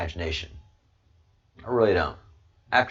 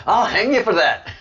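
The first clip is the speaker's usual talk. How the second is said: loud laughing fake threat, higher; laughing mock threat; 7 semitones higher, 4 dB louder than usual. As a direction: threaten through big laughter.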